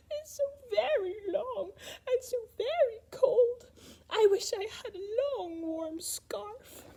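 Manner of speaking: high, slow, whimpering giraffe voice; sad giraffe. Acted. Giraffe telling George about her cold neck, high and whimpering. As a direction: sniffle it out, a sorry giraffe. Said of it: "sniffling sadly".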